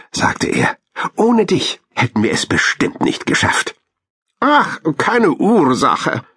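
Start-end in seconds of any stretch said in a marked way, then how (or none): 1.1-3.7 put-on high-pitched young hamster voice; grateful hamster. A narrator's reading. Hamster thanks the squirrel, high grateful voice. 4.4-6.2 high, drawn-out squirrel voice; bashful squirrel. A narrator's reading. Squirrel brushes off hamster's thanks, high bashful voice. Wischte verlegen mit ihrem Schwanz.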